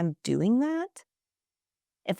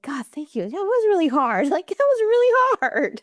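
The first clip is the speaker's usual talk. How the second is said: much higher and louder, laughing out the relief; relieved laugh; 10 semitones higher, 5 dB louder than usual. Retold or imitated. Host retelling her own grateful reaction, laughing. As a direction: gush with laughing relief at being seen. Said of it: "I was like".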